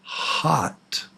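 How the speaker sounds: "hot" whispered with an open "ah" vowel; whispered "hot" for contrast with hat; whispered demo word. Shown as a demonstration. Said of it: "So hat".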